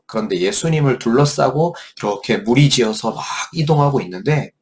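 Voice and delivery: ordinary delivery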